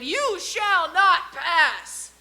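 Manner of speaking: slow, booming wizard roar, high and loud; Gandalf shout. Retold or imitated. Comedian quoting Gandalf to the audience, slow booming shout. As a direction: bellow it like Gandalf at the bridge. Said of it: "when he shouted".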